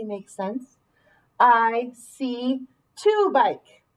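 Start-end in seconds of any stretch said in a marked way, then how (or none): 1.4-3.8 very slow testing read; teacher slowly tests a wrong word; slow trial read. Shown as a demonstration. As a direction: drag the words out to test the fit. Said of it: "doesn't really make sense".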